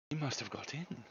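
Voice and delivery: soft, tense half-whisper; hushed excitement. Acted. Williams realising the figure entered the house, hushed tense. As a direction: breathe it out, awestruck. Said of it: "said Williams with great excitement".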